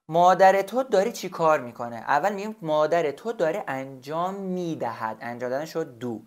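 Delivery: normal talking voice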